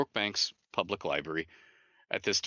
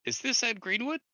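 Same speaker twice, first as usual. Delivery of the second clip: much higher, lightly imitated American caller; caller's voice; 14 semitones higher than usual. Jeff on the phone asking for Ed, voiced much higher. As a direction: pitch it way up for the voice on the phone. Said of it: "this voice on the other end says".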